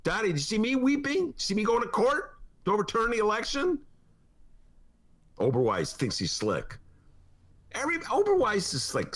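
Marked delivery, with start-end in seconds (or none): 0.0-3.8 mocking taunt. Mocking Trump as Daddy.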